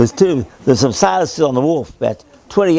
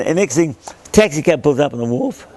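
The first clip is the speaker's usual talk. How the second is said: ordinary delivery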